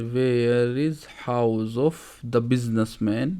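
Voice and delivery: very slow, word by word; slow classroom dictation. Shown as a demonstration. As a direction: lay each word out slowly for students. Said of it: "slow translation for students".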